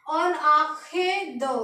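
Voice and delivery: on आंखें दो said slowly, on ankhen do; slow, careful on आंखें दो; French en arrière de. Shown as a demonstration. Teacher models French for behind, slowly. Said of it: "इसके पीछे के लिए लगाएंगे हम".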